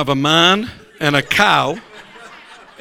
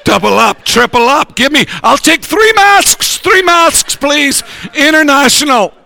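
panicked shout; 10 semitones higher, 6 dB louder than usual. Retold or imitated. Panicked mask buyer, shouting.

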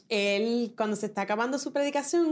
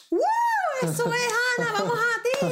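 high-pitched, whooping encouragement; mock crowd cheer; 12 semitones higher, 4 dB louder than usual. Congregation cheering Ana on as she walks, high mock cheer. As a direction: imitate a crowd rooting for someone.

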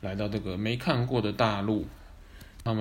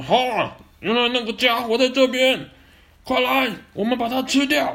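high, loud gloating predator; gleeful villain; 14 semitones higher, 7 dB louder than usual. Acted. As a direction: gloat like a hungry beast. Villain dinosaurs spot prey.

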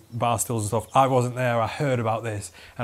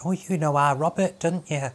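higher, chatty grandma voice; grandma impression; 5 semitones higher than usual. Grandma chatting to the workman, higher put-on voice. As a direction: imitate a friend's gran making small talk. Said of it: "she was like".

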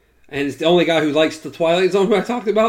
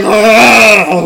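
mock shout; 5 semitones higher, 12 dB louder than usual. Mock mother dog giving birth.

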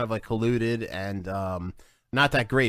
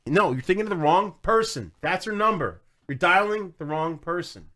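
1 frustrated raised voice; 7 semitones higher, 4 dB louder than usual. Real, not acted. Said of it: "And you're yelling at me".

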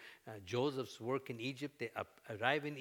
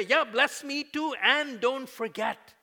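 daring prayer; 12 semitones higher, 13 dB louder, slower than usual. Acted. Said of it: "then you can dare to pray".

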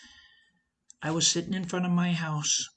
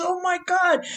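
much higher and louder, gushing with delight; excited brother; 12 semitones higher, 7 dB louder than usual. Retold or imitated. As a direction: imitate the little brother squealing with joy. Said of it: "He goes".

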